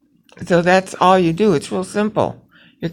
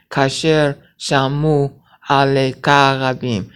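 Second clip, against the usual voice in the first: slow syllable reading; slower than usual. Shown as a demonstration. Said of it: "see how it's nine syllables".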